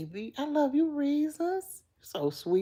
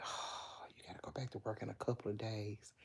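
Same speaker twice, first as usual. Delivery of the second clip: low, hushed, near-whispered groan; dejected day-off sigh; 8 semitones lower, 16 dB quieter than usual. Retold or imitated. Dreading work while lying in bed, imitated hushed sigh. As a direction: imitate dread under your breath, quick and low. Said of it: "you be like".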